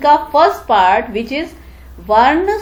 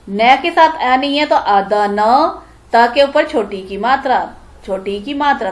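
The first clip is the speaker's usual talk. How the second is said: ordinary talk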